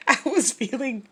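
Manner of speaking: laughing through the words. Real, not acted. Laughing at herself over the goobers girl. Laughing while telling story.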